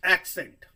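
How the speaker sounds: accent called out loud; teacher stressing a key term; emphatic term. Shown as a demonstration. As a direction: punch the word accent. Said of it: "it is sometime called".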